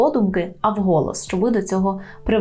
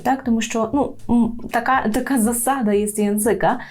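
ordinary talk, nothing marked

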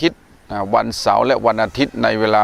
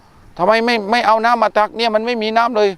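high-pitched scolding of a child, retold; a retold mock scolding, pitched high; imitating a parent snapping; 9 semitones higher, much slower than usual. Mother back from the fields scolds her child for no water, high mock scolding. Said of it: "ตะคอกลูก".

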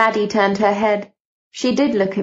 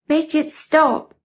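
whimpering plea; 7 semitones higher, slower than usual. Acted. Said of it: "Caroline was whimpering quietly".

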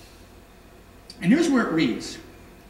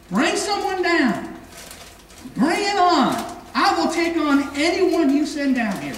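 Goliath's taunt; 7 semitones higher than usual. Acted. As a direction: shout the dare across the valley like a giant. Goliath challenges the Israelites.

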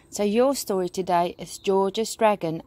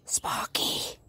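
soft, voiceless whisper of a name; 7 dB quieter than usual. A narrator's reading. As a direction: whisper it as a discreet warning. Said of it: "whispered George".

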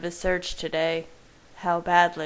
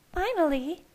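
high-pitched grumble, not softened; muttering Alice; 12 semitones higher than usual. A narrator's reading. Alice comments on Jacob's arrival, a high mutter. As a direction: grumble it in a much higher character voice. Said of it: "muttered Alice".

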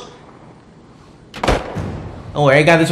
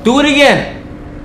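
taunt; 7 semitones higher than usual. Real, not acted. Daring the enemy to repeat.

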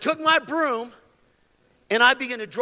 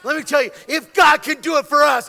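loud, driving preacher's delivery; fired-up preaching; 5 semitones higher, 9 dB louder than usual. Real, not acted. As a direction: preach it with full force, punching key phrases. Preaching at full force.

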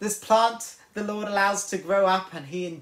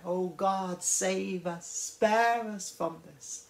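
crying out in prayer; 5 dB quieter, slower than usual. Retold or imitated. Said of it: "cry out to God in prayer".

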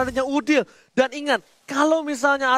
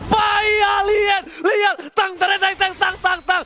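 high, yelling hype over a big play; excited shouting; 5 semitones higher, slower than usual. Real, not acted. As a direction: scream it out as the play lands. Wild play, caster erupts.

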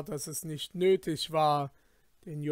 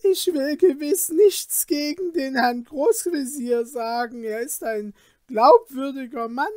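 higher, frightened pleading; trembling plea; 10 semitones higher, 9 dB louder than usual. Acted. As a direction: beg desperately, voice up high. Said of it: "erbleichend und zitternd".

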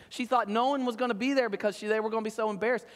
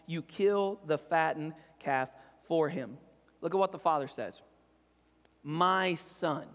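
scripture reading; 3 semitones lower than usual. Acted. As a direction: read the Bible passage to the congregation. Preacher reads the parable aloud.

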